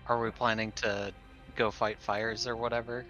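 ordinary delivery

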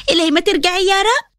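plain reading voice

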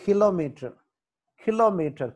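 Kilometre with a high, stressed "ki"; kilometre, high start, falling end. Shown as a demonstration. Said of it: "కే దగ్గర stress mark".

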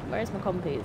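ordinary delivery

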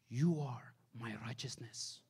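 usual speaking voice

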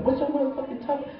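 high, fast mock woman's squeak; mock girl voice. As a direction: fire it back like a baffled girl. Woman's reply acted high.

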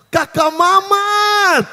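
high, stretched-out delighted call; excited fan. Retold or imitated. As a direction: greet him like a thrilled fan, slowly.

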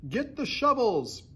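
high-pitched foreman's shout. A narrator's reading. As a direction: call out orders to the work gang. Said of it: "they heard him shouting".

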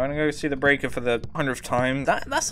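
ordinary talk, nothing marked

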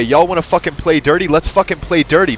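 normal talking voice

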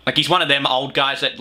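ordinary delivery

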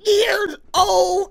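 high, loud, drawn-out cry; shocked disbelief. Real, not acted. Reactor learning that Cartman is eight, shocked high outcry. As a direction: stretch each word out in loud disbelief.

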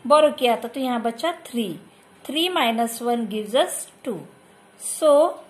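ordinary talk, nothing marked

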